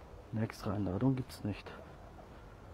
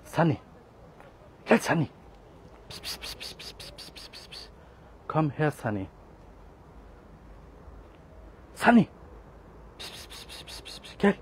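calling out; 5 semitones higher, 3 dB louder than usual. Real, not acted. Calling lost kitten repeatedly.

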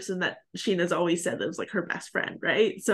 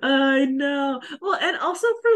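laughing; 5 semitones higher, 4 dB louder than usual. Real, not acted. Laughing reply to friend.

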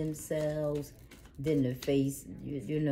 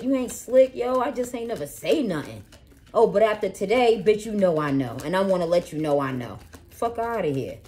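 higher and louder, cussing them out; angry tirade; 6 semitones higher, 6 dB louder than usual. Acted. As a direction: tell them off with full heat. Cursing out the hidden enemy.

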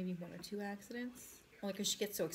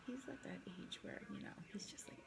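whispering; 11 dB quieter than usual. Real, not acted. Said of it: "I have to whisper".

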